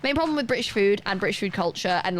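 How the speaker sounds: usual speaking voice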